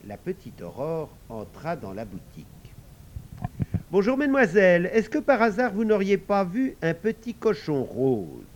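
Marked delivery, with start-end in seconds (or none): 3.9-8.5 a lightly voiced polite little girl, higher and louder; sweetly asking after the pig. Aurore politely asks the sisters about the pink pig, lightly voiced.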